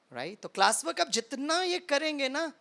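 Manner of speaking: usual speaking voice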